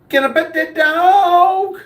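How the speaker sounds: high, slow begging voice; squeaky mock plea to pet the dog; pleading high voice. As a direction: beg like an eager kid wanting to pet the dog. Reading a dog-lover's chat comment.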